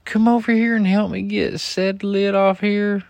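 high, loud put-on wife voice; mimicking wife calling out sweetly; imitating wife. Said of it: "And she was like".

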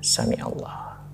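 soft, breath-only prayer phrase; whispered prayer. Retold or imitated. Passenger re-enacting rising slowly from bowing, whispered prayer. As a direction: whisper it as you slowly rise.

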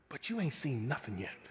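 low and soft, a confiding promise; hushed God voice. Retold or imitated. Preacher voicing God promising his people, low and hushed. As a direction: lean in and drop it to a near hush. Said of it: "God says".

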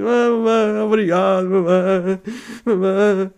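high sing-song thanks to mum; hammed-up childish sing-song; grateful little kid. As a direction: coo thankfully like a rescued child. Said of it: "e eu tipo".

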